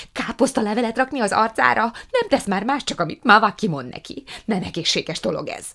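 shouting. A narrator's reading. Prue rails to mama about Margó, shouting. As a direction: rant in outrage, easing at the end. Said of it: "kiáltotta Prue".

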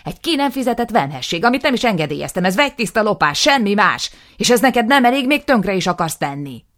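loud, high, escalating tirade; furious rant. Acted. Nisim raging at his brother, loud and high. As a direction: build to a furious yell.

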